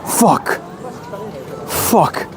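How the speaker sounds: usual speaking voice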